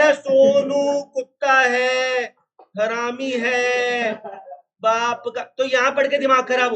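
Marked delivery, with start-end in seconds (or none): 0.1-4.1 slow and deliberate. Shown as a demonstration. Reads the exam-style example.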